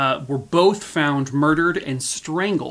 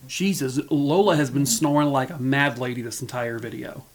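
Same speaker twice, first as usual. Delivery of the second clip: usual speaking voice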